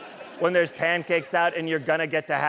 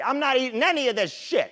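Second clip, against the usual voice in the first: high, proud refusal; defiant declaration; 8 semitones higher than usual. Retold or imitated. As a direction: announce it with gusto. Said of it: "and I say".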